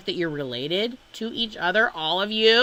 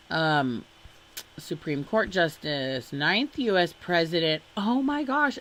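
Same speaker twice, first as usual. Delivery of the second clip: normal talking voice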